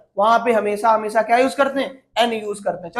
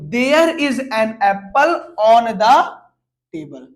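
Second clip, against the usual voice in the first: slow reading; much slower than usual. Shown as a demonstration. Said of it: "reads the corrected sentence slowly".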